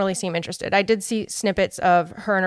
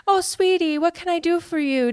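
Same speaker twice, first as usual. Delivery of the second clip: sugary fake posh accent, pitched much higher; mock Dorit accent; 9 semitones higher than usual. Mimicking a housewife doting for the cameras, high fake accent. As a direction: coo it in an affected fake accent. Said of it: "her terrible accent".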